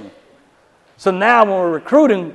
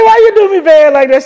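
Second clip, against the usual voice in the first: much higher, louder playful whine; husband teasing wife for letting him lie, high loud whine; mock hurt; 10 semitones higher, 7 dB louder than usual. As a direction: wail it as mock betrayal.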